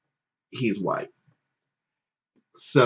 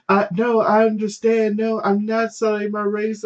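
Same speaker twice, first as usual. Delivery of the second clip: mock girlfriend voice; 11 semitones higher than usual.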